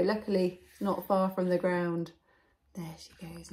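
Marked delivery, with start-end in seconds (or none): 2.7-3.5 soft whispered aside; hushed. A narrator's reading. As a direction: whisper it as the girl slips away. Aside as Goldilocks flees.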